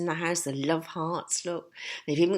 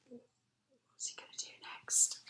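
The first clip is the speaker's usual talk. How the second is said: whisper; 6 semitones higher, 14 dB quieter than usual. Real, not acted. Whispering to child.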